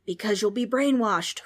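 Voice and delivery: loud ghostly roar. Acted. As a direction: roar it at the kids. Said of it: "roared the ghost".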